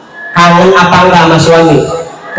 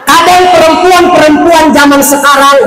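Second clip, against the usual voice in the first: much higher, mocking sing-song; mocking women; 11 semitones higher than usual. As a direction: parody a fickle wife. Mocking modern wives.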